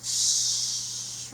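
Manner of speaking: long unvoiced shh; shh sound. Shown as a demonstration. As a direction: breathe out a steady shh. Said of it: "if you make the shh sound".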